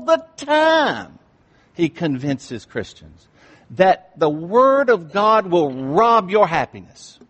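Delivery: full-force sermon voice; fiery preaching. Acted. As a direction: preach it hard to the congregation.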